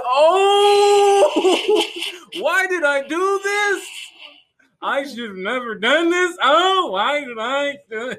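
high, drawn-out crybaby wailing; mock panicked wail. Friend mimics his cousin facing police, mock panicked wail. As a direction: imitate a man wailing in slow, high-pitched despair. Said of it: "he was panicking".

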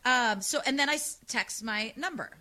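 ordinary talk

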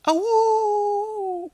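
loud high wolf howl; Wolfman Jack howl. Retold or imitated. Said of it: "Wolfman Jack here".

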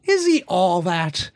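loud, slow, drawn-out mock question; teasing mimicry. Guest parroting the host's probing question, slow teasing mimicry. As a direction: stretch it out to tease.

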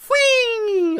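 high, loud gleeful squeal; gleeful whee. Retold or imitated. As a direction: shriek with joy going overboard. Character falls off the boat.

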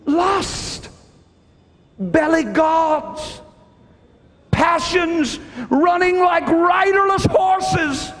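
high, slow, shouted sermon peak; fiery preaching. Acted. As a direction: thunder it from the pulpit. Preaching climax on gluttony.

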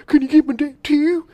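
very high mock kid voice; C. Thomas Howell. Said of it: "C. Thomas Howell's".

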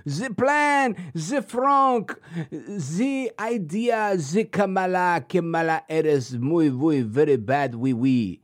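badly faked French, words stretched out; very poor French accent. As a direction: make fun with a deliberately bad, drawn-out French accent. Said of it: "doing a very poor French accent".

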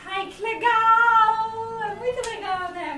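high, loud, drawn-out squeal of delight; squealed excitement. Retold or imitated. Dubber recalling her dubbed line with a high squeal. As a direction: sing out the delight like the character. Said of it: "ai, que eu falei assim".

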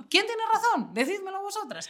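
high-pitched, half-laughing plea; mock-desperate appeal. Host asking listeners who is right, high mock-desperate plea. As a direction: squeak the question up high, playfully desperate.

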